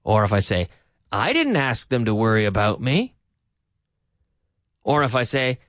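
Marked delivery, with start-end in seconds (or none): 1.1-3.1 early emphasis on "I didn't"; stress on I DIDN'T. Shown as a demonstration. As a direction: hit "I didn't" at the start, end upward. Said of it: "the intonation is very important".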